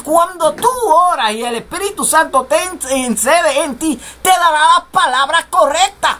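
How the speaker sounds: ordinary talk, nothing marked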